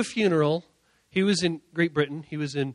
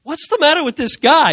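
high, loud, aghast question; mock outcry; 8 semitones higher, 10 dB louder than usual. Funeral crowd reacts to the preacher, loud disbelief. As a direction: cry out like an alarmed mourner. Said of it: "everybody's like".

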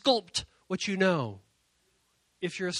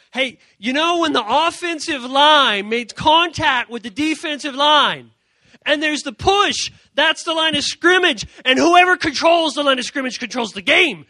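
pumped-up, louder play-by-play pitch; eager sports talk; 6 semitones higher, 8 dB louder than usual. Pretend. Talking sports to a twelve-year-old girl, eager and loud. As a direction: explain football like a hyped coach. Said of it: "be like".

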